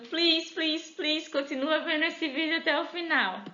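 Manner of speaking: high, pleading please; mock pleading. Teacher begging students to keep watching, high pleading voice. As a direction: beg the viewer sweetly to stay. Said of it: "begging viewer to keep watching".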